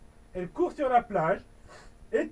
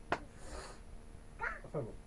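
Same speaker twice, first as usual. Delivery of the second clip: whispered aside; 4 semitones higher, 16 dB quieter, much slower than usual. Real, not acted. Aside breaking off rant.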